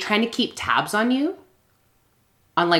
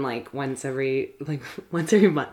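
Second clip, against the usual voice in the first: muffled, said quietly behind a hand; hand over mouth; 3 semitones lower, 7 dB quieter than usual. Real, not acted. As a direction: cover your mouth and say it softly. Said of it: "You said that so quietly with your hand over your mouth".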